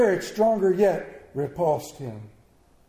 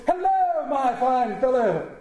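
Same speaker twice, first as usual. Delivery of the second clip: high, ringing greeting; hearty hail; 5 semitones higher, 4 dB louder than usual. A narrator's reading. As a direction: call out joyfully to a boy below. Scrooge hails the boy.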